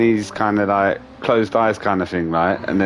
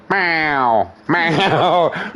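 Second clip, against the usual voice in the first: higher, drawn-out yowl; insistent cat wanting in; imitating a cat's meow; 5 semitones higher than usual. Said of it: "then he comes back".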